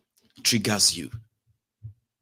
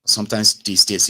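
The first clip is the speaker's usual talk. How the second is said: normal talking voice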